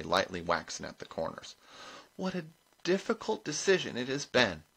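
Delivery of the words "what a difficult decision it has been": high, bright hostess voice; put-on brightness for the crowd. A narrator's reading. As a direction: announce it brightly in a raised pitch. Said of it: "she said brightly".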